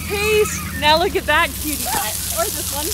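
loud, bright showing-off; excited. Real, not acted. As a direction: gush over the cute pictures. Excited showing toddler's photos.

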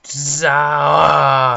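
loud battle cry zah; enraged boss roar. Pretend. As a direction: bellow it like a raging boss. Attacking the bar with spell.

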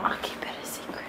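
hushed secret promise; whispering. Real, not acted. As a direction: whisper it conspiratorially.

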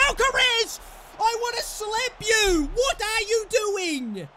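high, loud, drawn-out scolding; furious shout. Real, not acted. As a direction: berate the player at full voice. Berating a player.

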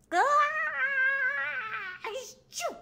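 high, loud build-up to a sneeze; big sneeze. Acted. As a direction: wind up and sneeze. Hamster sneezes.

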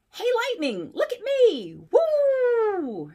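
high, loud, gleeful tow truck; excited cartoon tow truck calling; Mater's voice. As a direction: whoop it up as the hillbilly tow truck. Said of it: "Mater sledged past".

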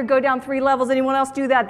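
ordinary delivery

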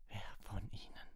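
hushed, whispery, trembling question. A narrator's reading. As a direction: ask with barely held trembling, softly. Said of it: "sagte sie mit zitternder Stimme".

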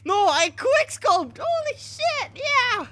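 high, loud, slow brag; triumphant shout. Real, not acted. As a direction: scream your victory. Celebrating the quickscope.

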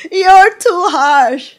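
high, loud, slow mock echo of the complaint; mocking repeat.

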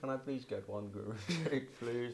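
soft, slow Widowy voice breaking into a laugh. Acted. Mate has a go at the bit, soft Widowy voice. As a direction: quietly try the w-for-r voice, giggling. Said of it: "fine, I'll do that".

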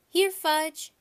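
loud, high call. A narrator's reading. Peter calling for Fudge near the front, loud high.

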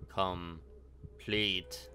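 low, slow otherworldly voice; creature voice. Acted. Creature answering what was done to Brian, low intoned voice.

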